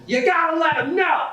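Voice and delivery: very high, squeaky stranger yelling; shrill mock yell. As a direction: screech it like an unhinged street guy. Stranger insisting.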